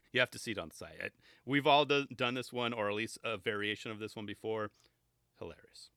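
ordinary talk

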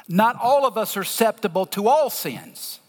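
ordinary delivery

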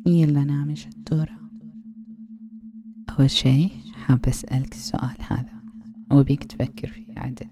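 mocking, degrading hushed taunts; taunting whisper. Aunt taunting the listener about his wife, mocking whisper. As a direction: belittle him in a pressing whisper.

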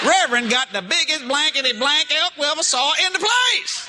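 mock awe, building to a yell; hammed-up amazement. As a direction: imitate hunters gaping at a huge catch. Acting hunters amazed at his elk.

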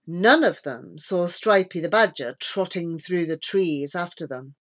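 plain reading voice

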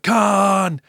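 loud, high mock yell of the name; Kirk yell. Imitating Captain Kirk yelling at his enemy, loud. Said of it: "yelling out".

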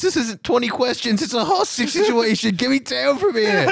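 high, fed-up captive; exasperated hostage. Acted. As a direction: snap with impatience.

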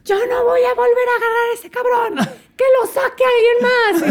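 high, strained hammed-up refusal; mock exasperated yell. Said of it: "Y dice en el radio". (berrinche exasperado fingido, muy agudo)

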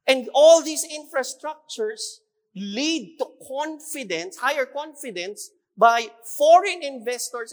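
loud, rousing sermon delivery; fiery preaching. Acted. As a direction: build it up like a preacher rallying the crowd. Preaching about national progress.

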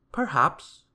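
high, loud, teasing wizard; wordy wizard. A narrator's reading. Wizard answering a begging Mister Mean, high and loud. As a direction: pipe up as the wizard. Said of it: "replied the wizard".

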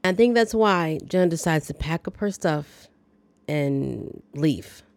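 ordinary talk, nothing marked

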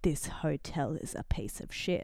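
low, soft smirking dig; smirking imitation. Imitating a hotel guest insulting the place, smirking. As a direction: imitate a smirker's quiet put-down, pitched low. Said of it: "he did a smirk like".

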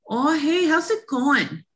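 rez accent demo, high sing-song rise on hey; Res accent. Shown as a demonstration. Showing listeners the reservation tone, lightly sing-song rez accent. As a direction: sing the line up like a reservation Native English speaker. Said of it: "Talk like this".

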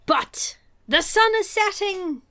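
high, loud, hammed-up panic; mock alarm. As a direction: cry out in fake dismay.

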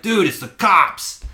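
high, loud, drawn-out alarm; retold urgent warning. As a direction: stress it to a clueless drunk friend.

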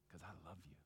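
unvoiced whisper of love. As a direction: breathe it as a secret. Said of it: "and sounded like this?".

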